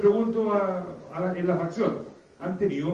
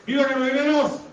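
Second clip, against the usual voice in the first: raised, higher-pitched cheer call; rallying shout; 6 semitones higher, 4 dB louder than usual. Real, not acted. Director rallying officers to cheer for Carabineros, rallying shout. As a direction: call out to lead a group cheer. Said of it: "si me ayudan a gritar".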